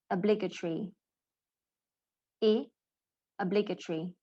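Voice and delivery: "obligatory" with stressed "blig", the short "ih" also said alone. Shown as a demonstration. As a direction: model "obligatory" with a short i in the second syllable. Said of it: "It's actually".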